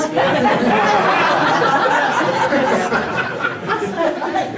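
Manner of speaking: loud, long laugh at his own joke; a real big laugh; hearty laugh. Real, not acted. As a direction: let the laugh roll out. Laughs at the man moose joke.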